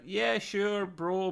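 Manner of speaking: hesitant, noncommittal quote; reluctant agreement. Retold or imitated. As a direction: mildly imitate a reluctant yes. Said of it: "he's a bit reluctant to high five".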